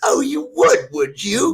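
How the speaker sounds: high, loud mock outrage; sneering sergeant shout. Retelling the sketch, the sergeant yells high and loud at a soldier.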